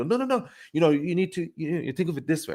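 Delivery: high-pitched imitation of an objector; mock objection. Debate opponent trying to turn the argument, high mock objection. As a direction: jump up high, acting out someone protesting.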